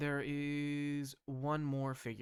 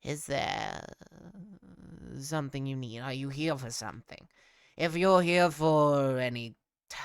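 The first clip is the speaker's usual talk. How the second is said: a hint of a gleeful goblin declaring guilt; tall goblin judge; 8 dB louder than usual. Tall goblin sentencing the visitors, lightly gleeful. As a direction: rattle off guilty, guilty with relish.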